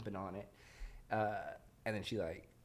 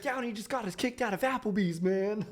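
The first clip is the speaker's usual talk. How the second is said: much higher, dopey put-on voice; mock vlog character, pitched way up; goofy character voice; 11 semitones higher, 5 dB louder than usual. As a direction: ham up the silly vlog guy.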